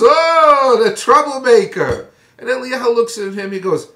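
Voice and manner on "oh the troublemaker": loud, much higher scornful jeer; sneering accusation. Retold or imitated. King Achav greeting Elijah with a loud scornful sneer. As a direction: spit it out like a contemptuous king. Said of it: "King Achav looks at Eliyahu and goes".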